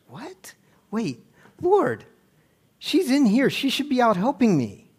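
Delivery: high, loud complaining sister voice; exasperated Martha, pitched high and loud; indignant Martha. Retold or imitated. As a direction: whine it up high like an offended hostess. Martha complains, acted quote.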